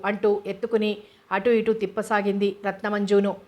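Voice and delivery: plain reading voice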